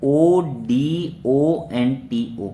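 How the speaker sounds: letters dictated slowly, one by one; slow spelling out. Shown as a demonstration. Teacher to students, spelling the root slowly. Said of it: "ओडोंटो क्या होता है".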